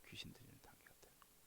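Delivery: 귀신 들리는 whispered fast, unvoiced and hushed. Shown as a demonstration. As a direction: breathe 귀신 들리는 quickly like a secret. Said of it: "whispered repeat".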